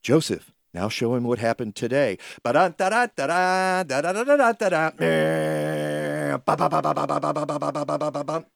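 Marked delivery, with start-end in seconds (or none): 2.4-8.4 babbling sound effects in a put-on voice; fast silly babbling; mouth-made scene noises. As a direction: rattle off nonsense syllables like a cartoon soundtrack. Joseph shows him what happened.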